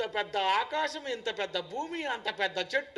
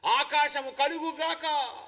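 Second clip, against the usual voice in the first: slow, ringing proclamation; forceful divine command; 5 semitones higher, 5 dB louder, slower than usual. Shown as a demonstration. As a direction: call creation into being with a drawn-out decree. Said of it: "దేవుడేదో స్లోగా".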